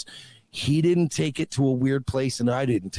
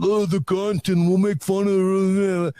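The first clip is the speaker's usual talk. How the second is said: mocking voice; 5 semitones higher, 4 dB louder, slower than usual. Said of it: "if you think he's just like".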